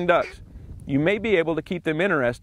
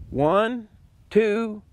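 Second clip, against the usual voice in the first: falling rhythm count; 4 semitones higher, much slower than usual. Shown as a demonstration. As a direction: step the count down, fading to the last. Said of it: "count the quacks in your head to help you with the rhythm".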